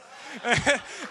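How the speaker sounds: a bright real burst of laughter. Real, not acted. Laughs at punchline.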